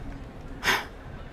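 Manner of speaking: a soft, heavy sigh after the memory; sad sigh. Acted. Natty after telling of her father's death, soft sad sigh. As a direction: breathe out the grief quietly.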